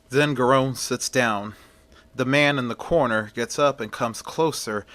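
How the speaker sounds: plain reading voice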